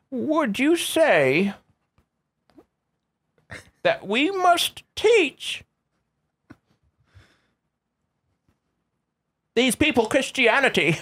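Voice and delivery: high, slow, pompous explorer voice; drawn-out high missionary voice; put-on character voice. RPG character voice, cannibal round.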